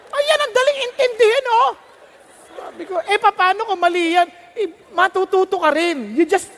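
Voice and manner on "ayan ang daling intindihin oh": high excited exclaim; pleased surprise; delighted. Retold or imitated. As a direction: gush over how easy it reads. Mimics delight at easy Tagalog.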